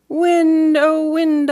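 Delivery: high, loud call up to the treetop. Acted. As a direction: call the wind to come back. Child calling up to wind.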